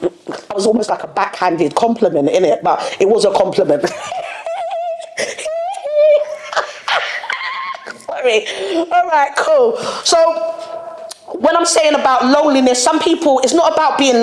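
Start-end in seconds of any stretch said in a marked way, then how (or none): 3.9-8.0 long, high-pitched giggling fit; high laugh. Real, not acted. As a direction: break into a long, squeaky laugh. Laughing at own joke.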